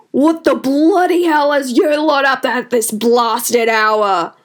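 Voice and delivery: roaring. A narrator's reading. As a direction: bellow at the crowd in outrage. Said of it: "he roared".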